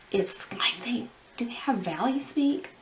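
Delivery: whispered aside. Real, not acted. Vlogger whispering a cheeky question to viewers. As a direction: whisper it like a secret. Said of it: "whispered aside".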